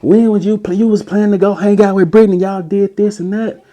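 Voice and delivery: high-pitched jealous rant; high accusing girlfriend. Retold or imitated. Ex accusing him about Brittany in a shrill voice. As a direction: throw the accusations in her voice.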